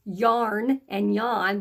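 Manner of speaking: yarn and yawn said slowly side by side; slow contrast. Shown as a demonstration. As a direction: stretch both words to compare. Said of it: "Boy, those sound close".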